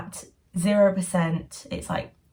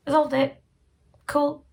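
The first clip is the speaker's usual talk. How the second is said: mock breezy; 8 semitones higher than usual. Mocking quick resolution.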